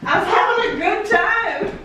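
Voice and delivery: breathless after rocking out; puffing between words; out of breath. Real, not acted. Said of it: "I'm out of breath from rocking too hard".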